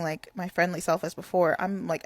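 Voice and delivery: ordinary talk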